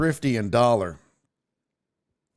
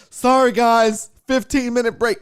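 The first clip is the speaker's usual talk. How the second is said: high, louder mock breezy dismissal; dismissive clerk; 9 semitones higher, 6 dB louder than usual. Imitating the rental clerk leaving on break, mock breezy. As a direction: brush off the crowd. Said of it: "She's like".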